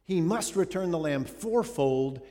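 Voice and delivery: loud, slow outrage at the injustice; an indignant king demanding justice; indignant outburst. Acted. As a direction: boom out the verdict in righteous fury. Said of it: "Oh, he's indignant".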